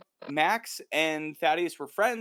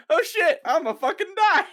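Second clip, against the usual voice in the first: much higher and louder panicked yelp; mock panic; 8 dB louder than usual. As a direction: squeal it like you just saw your own foot. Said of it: "He's like".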